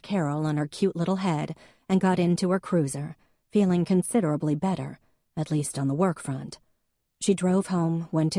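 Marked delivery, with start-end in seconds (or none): none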